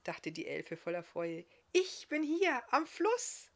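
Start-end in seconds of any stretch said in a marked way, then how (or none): 1.7-3.5 high, loud, excited elf; excited reply, pitched well up. A narrator's reading. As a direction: call it out in excitement, drawing it out. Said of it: "antwortete sie dem Hund aufgeregt".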